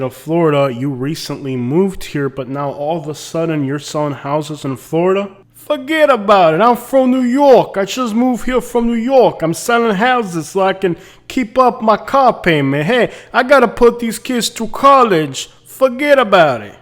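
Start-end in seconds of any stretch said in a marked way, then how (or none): 5.5-16.8 put-on loud, high-pitched New York accent; New Yorker accent. Realtor answering a client about moving down, New York accent. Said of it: "picked up a New Yorker accent".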